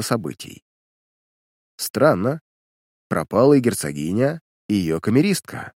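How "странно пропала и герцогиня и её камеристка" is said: ordinary delivery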